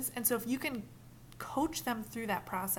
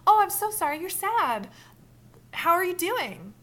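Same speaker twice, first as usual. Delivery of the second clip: loud, high, gushing pity; too much fake concern; overdone sympathy; 9 semitones higher, 10 dB louder than usual. Shown as a demonstration. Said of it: "it might be good not to be like".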